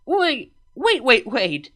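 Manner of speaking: quick urgent cutting in; urgent interrupting. A narrator's reading. Luna cutting off her sister, urgently. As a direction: stop her mid-sentence. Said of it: "Luna interrupted".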